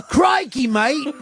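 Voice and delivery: high, hammed-up Australian "Crikey, mate!"; mock Aussie exclaiming "Crikey, mate!" with a laugh; playful Australian "Crikey, mate!" impression; Australian accent. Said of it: "strong Australian accent".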